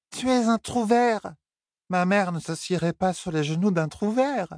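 high, pushy slurred demand; insistent drunk Elaine. Acted. Elaine demanding Tom explain her mother, high and slurred. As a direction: press him for the truth, tipsy.

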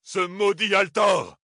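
cri rageur, aigu et lent; maudis-le de toute ta rage; Toram furieux. A narrator's reading. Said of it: "s'écria Toram". (Toram maudit Altor en criant)

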